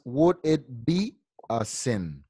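slow, word-by-word teacher's reading; measured demonstration, each word set apart; model reading. Shown as a demonstration. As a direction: read it out slowly for a learner to repeat. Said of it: "Ok, una vez más".

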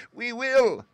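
very high, loud squeal; shrill mouse chorus. A narrator's reading. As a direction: cry out shrilly like eager mice. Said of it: "cried all the mice in a shrill chorus".

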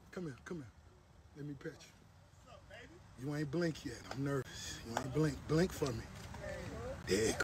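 soft, hushed coaxing to a buffalo; hushed pet talk to the buffalo; coaxing softly. Real, not acted. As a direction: gently sweet-talk a nervous animal.

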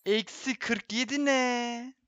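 real dazed disbelief; stunned disbelief. Real, not acted. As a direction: say it slowly, stunned. Big point loss, disbelief.

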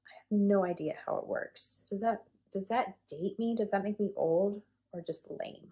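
ordinary talk

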